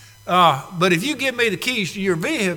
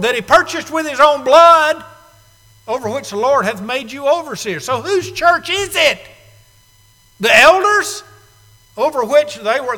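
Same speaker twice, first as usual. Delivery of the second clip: preaching at full force; 6 semitones higher, 5 dB louder than usual. Real, not acted. Preaching climax.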